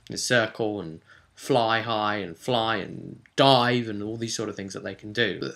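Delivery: usual speaking voice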